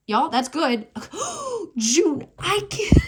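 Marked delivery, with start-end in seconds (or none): 0.9-1.7 a high, sudden intake of breath; sharp gasp. Real, not acted. Reader seeing the June card: a sharp high gasp. 1.8-3.1 high and loud, breaking into a laugh; can't believe it, laugh through the words; laughing in disbelief. Real, not acted. Reader reacting to the June card: laughing, high and loud.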